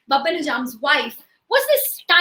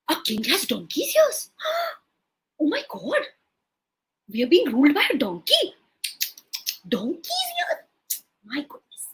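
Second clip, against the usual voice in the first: scandalised townsfolk, gasping between phrases; acted shock with gasps; shocked public; 2 semitones lower, 3 dB quieter than usual. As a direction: react in hushed, halting disbelief. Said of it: "general public who's just heard".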